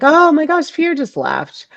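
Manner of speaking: high, loud "oh my gosh" then easing down; a burst of delighted surprise that settles; surprised relief. Retold or imitated. Retold sudden relief.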